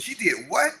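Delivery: mom can't believe what her son went through; indignant mom. Retold or imitated. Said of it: "My mom was upset".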